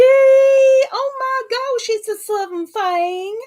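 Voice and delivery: high, drawn-out Southern greeting; hammed-up Southern twang, stretched and high; mock Southern accent. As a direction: exclaim it in a put-on Southern accent. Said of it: "hasn't quite got that accent".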